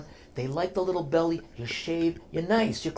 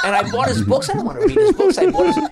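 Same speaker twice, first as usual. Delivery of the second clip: laughing; 6 dB louder than usual. Real, not acted. Laughing while retelling his blunder.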